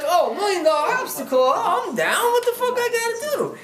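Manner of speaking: high, excited re-enacted reply; eager acceptance. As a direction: jump at the challenge, pitch climbing to the end. Acting his eager reply to challenge.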